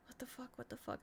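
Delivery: breathless stunned whisper; hushed, numb whisper; whispered disbelief. Retold or imitated. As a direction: mouth it to yourself, frozen. Frozen inner reaction.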